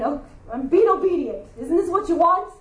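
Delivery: a hint of sulky pride; self-righteous mock. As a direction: sound put out about obeying. Mock self-righteous inner thought.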